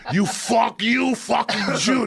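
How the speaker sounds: high mock yell breaking into laughs; yell through laughter. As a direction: laugh while you shout it again. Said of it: "he'd yell at the kid".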